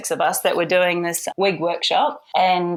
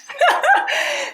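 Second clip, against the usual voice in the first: a real high burst of laughter; giggling at her own story; 3 dB louder than usual. Real, not acted. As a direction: let the laugh break out high. Laugh after the punchline.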